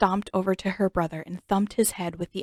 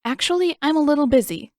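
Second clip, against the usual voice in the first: higher, louder flustered excuse; young monk squeaking out an excuse; startled evasion; 8 semitones higher, 7 dB louder, faster than usual. Acted. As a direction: dodge it like a nervous kid. Aang startled, dodging practice.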